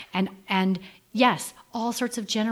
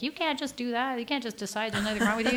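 higher-pitched scolding of imagined critics; mock objection; 5 semitones higher than usual. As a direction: imitate people protesting at you. Voices critics objecting to her.

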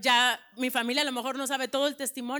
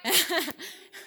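a short real laugh after the amen; brief laugh to the congregation; light laugh; 5 semitones higher than usual. Real, not acted. Testimony speaker laughs between points.

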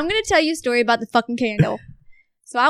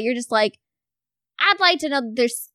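normal talking voice

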